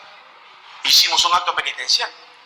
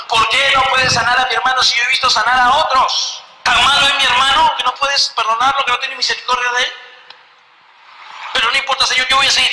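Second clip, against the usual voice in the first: higher, louder desperate questioning; anguished plea; 7 semitones higher, 3 dB louder than usual. Retold or imitated. Brother questioning God over his dying brother, anguished. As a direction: demand answers from God, then resolve. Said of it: "porque yo decía".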